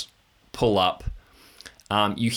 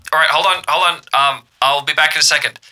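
hurried radio voice; 5 semitones higher, 8 dB louder, faster than usual. Acted. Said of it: "and like".

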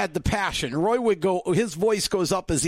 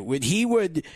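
normal talking voice

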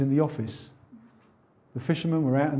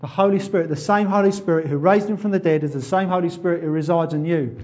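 ordinary talk

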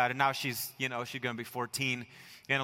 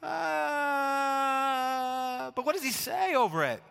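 mock shrug; 11 semitones higher than usual. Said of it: "the Creator not saying".

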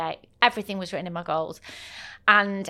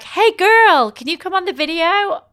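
much higher and louder, bubbly American hostess; gushing American greeting; 11 semitones higher, 7 dB louder than usual. Retold or imitated. Event staff spotting a Brit, gushing much higher and louder. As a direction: squeal it like an overexcited event host. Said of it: "they spot an English accent and they're like".

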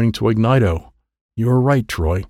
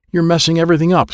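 mild scream, higher and fast; quick, pitched-up mock scream; Gloopy; 6 semitones higher, faster than usual. A narrator's reading. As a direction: rush it out in annoyed protest. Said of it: "screamed Gloopy".

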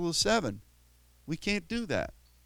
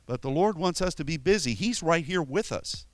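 usual speaking voice

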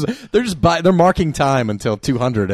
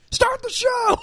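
high shout at Ryan; yelling; much slower than usual. Retold or imitated. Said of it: "That's you yelling at Ryan".

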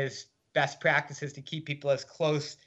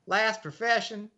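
higher, doubting nurse question; skeptical nurse; 7 semitones higher than usual. Retold or imitated. Nurse doubting the new inmate, higher and skeptical. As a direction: ask like you don't buy it. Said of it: "She shot me a skeptical look".

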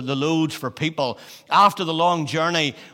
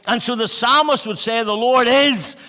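raised, emphatic preacher voice; preaching; 7 semitones higher, 6 dB louder than usual. Acted. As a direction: preach it out to the church. Preaches at full force.

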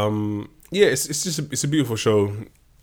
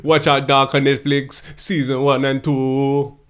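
slow, drawled fake Jamaican accent; hammy Jamaican accent, like a dancehall shout-out; 3 semitones higher, 4 dB louder, slower than usual. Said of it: "that was a terrible Jamaican accent".

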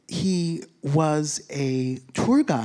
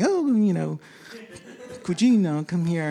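Italian impression; 4 semitones higher than usual. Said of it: "they're not like Italians or something".

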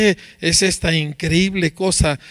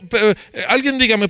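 ordinary talk, nothing marked

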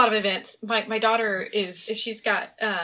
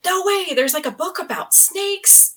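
higher, drawn-out excited squeal of a quote; excited fan; 9 semitones higher, slower than usual. Retold or imitated. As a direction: imitate a thrilled young fan discovering it. Delighted fans' reaction, acted out.

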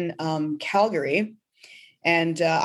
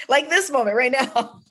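high, louder words through a laugh; 7 semitones higher, 4 dB louder than usual. Real, not acted. Guest laughing with the host about their shared tears. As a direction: laugh as you point to the moment.

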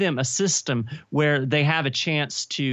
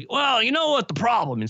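lightly voiced old man; a hint of an old man voice; 8 semitones higher than usual. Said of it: "so this is my old man voice".

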